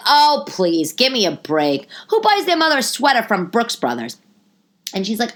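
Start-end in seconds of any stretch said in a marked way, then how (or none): 0.1-4.2 raised, dismissive retort; acted-out incredulous brush-off; scornful disbelief. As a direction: scoff at a feeble excuse. Scoffing at aunt's excuse.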